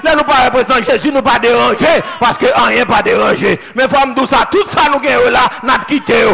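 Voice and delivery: loud, rhythmic Haitian sermon cadence; fiery pulpit preaching building up; full-force preaching. Acted. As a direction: preach it out to the whole church. Preaching to the congregation.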